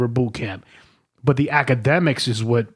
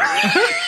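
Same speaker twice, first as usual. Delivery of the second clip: high laugh. Real, not acted. Storyteller cracking up at his own tale, high laugh.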